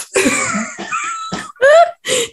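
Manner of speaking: big shrieking burst of laughter. Real, not acted. Laughing hard at the listener's dress mishap, shrieking laugh. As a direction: howl with real laughter. Reacting to embarrassing dress story.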